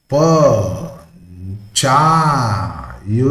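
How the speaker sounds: ಪ, ಚಾ, ಯು held, with long gaps between; syllable by syllable. Shown as a demonstration. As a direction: break it into three separate beats: ಪ, ಚಾ, ಯು. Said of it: "three syllables ಅನಿಸ್ತದೆ".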